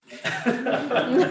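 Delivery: loud high laughter; real laugh. Real, not acted. After the colleague's joke, a loud high laugh.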